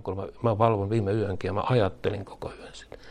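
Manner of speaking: hushed whispered confession, playing a worrier; mock whisper. Believer whispering of a sleepless night, mock whisper. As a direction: whisper it as if up all night fretting.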